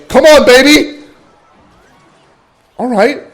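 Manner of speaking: loud, high yell at the screen; real gamer shout; hype cheer. Real, not acted. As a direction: celebrate the play out loud. Cheering a game play.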